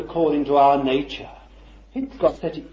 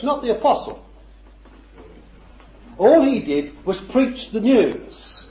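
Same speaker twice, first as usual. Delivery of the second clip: fervent preaching; 3 semitones higher, 4 dB louder than usual. Real, not acted. Preacher proclaiming to his congregation that Jesus is here, fervent. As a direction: preach it out with rising fervour.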